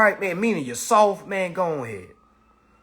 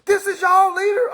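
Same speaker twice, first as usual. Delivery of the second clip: much higher, louder mocking call-out; mocking poster; 5 dB louder than usual. Critic posting screenshots to followers, mocking. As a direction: gloat like a troll showing off a screenshot. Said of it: "they go post it".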